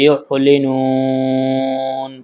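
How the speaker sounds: ইউহলিনুন very slow with the throat squeezed; pressed throat ain. Shown as a demonstration. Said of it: "গলা চেপে হলকের মধ্যখান থেকে".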